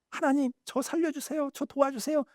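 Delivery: high, soft pleading prayer; mock desperate prayer. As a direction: beg God, high and hushed. (높고 작은 목소리로 간절히 매달리며 기도하기)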